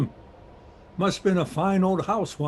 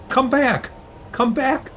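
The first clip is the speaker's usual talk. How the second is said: raised, urgent call; higher-pitched cry of alarm; calling after someone; 6 semitones higher than usual. Acted. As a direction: call him back from the storm. Calling man back from storm.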